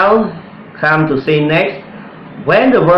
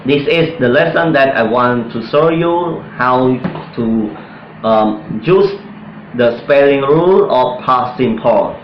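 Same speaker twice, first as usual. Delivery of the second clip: ordinary talk, nothing marked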